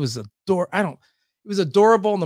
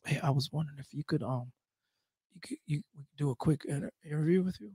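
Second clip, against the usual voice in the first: much softer, fast and hesitant, a nervous ask; shy young interviewer; 5 semitones lower, 12 dB quieter, faster than usual. Retold or imitated. Young fan asking the radio host for an interview, softly and shyly. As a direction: mumble it shyly, eager but nervous. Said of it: "he goes".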